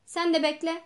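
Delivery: loud snap at a customer; shouting. A narrator's reading. Postmaster snapping at a woman at the counter, loud. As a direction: bark it out. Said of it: "diye bağırdı".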